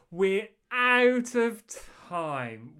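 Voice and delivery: drawn-out, high and loud; dramatic announcement. Acted. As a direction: stretch it out for drama. Dramatic end-of-show announcement.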